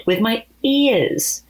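slow, patient explaining; patiently. Acted. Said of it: "said Opie patiently".

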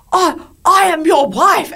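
high, slow, affronted retort; pretend-hurt wife putting husband in his place; mock indignation. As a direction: drag it out, wounded and haughty.

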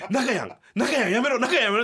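high, loud laughing plea to stop; urgent pleading. Retold or imitated. Holding back the raging partner, loud urgent plea. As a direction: hold him back in a panic.

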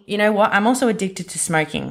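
ordinary delivery

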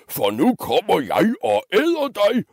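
high, swooping monster threat; roaring troll. Acted. Troll threatening to eat the middle goat, high roar. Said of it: "brølede trolden".

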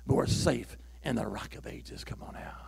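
breathy whispered assurance, no voice. Acted. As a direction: lean in and whisper it to the church.

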